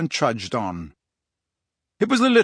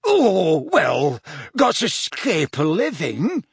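drawn-out character voice, pitched up at the start; Mundungus; 4 semitones higher, slower than usual. A narrator's reading. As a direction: put on a slow rogue's voice for an excuse. Narrator voices shifty Mundungus' offhand excuse.

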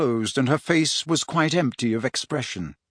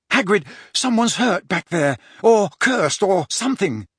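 urgent; 6 semitones higher than usual. Acted. Harry urgently reporting injury.

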